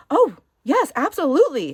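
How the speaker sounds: gushing retold yes, pitched well up; eager agreement. Waitress answers "can I be Frank", eagerly. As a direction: imitate an eager waitress, loud and high.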